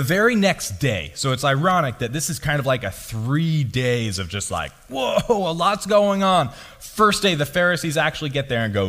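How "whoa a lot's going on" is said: high, drawn-out wow of disbelief; mock amazement. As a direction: stretch it out, pitched up, as if overwhelmed. Voicing amazement at three eventful days.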